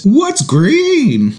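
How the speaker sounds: high, fake-innocent kid voice; feigned ignorance. Dad mimicking his son faking ignorance in a high voice. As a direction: play dumb like a sly child. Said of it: "He feigns ignorance and says".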